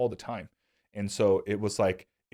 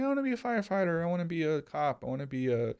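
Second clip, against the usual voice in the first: put-on eager kid voice; 7 semitones higher than usual. Classmates answer the teacher on career day, imitated kid voice. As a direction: imitate schoolkids piping up in turn.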